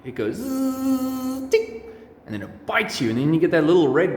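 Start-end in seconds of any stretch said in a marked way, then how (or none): 0.0-1.5 high, slow buzzing zzz; mosquito buzz. Retold or imitated. Teacher showing pupils a mosquito, high slow buzz. 1.5-1.8 very high sharp tick; bite sound. Retold or imitated. Teacher acting the mosquito bite, squeaky tick.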